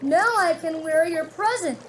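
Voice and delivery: bursting with news; a lightly voiced excited toad. A narrator's reading. Toad excited about hat.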